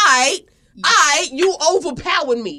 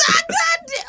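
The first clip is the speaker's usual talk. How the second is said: crying shout. Real, not acted. Retelling being jumped.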